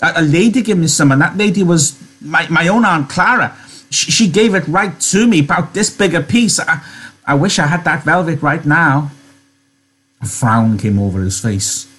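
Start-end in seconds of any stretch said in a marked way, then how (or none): 0.0-9.1 put-on high, stumbling childlike excitement; happy, eager. Lenny remembering Aunt Clara's velvet, happy and excited. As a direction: tumble through it with simple pleasure. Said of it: "he cried happily".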